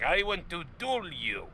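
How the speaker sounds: high, slow, hammed-up challenge; pissy duelist. Retelling a film's duel challenge, mock pissy. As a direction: imitate a petulant duel challenger. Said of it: "the one guy gets pissy".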